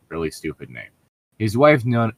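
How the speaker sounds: ordinary talk, nothing marked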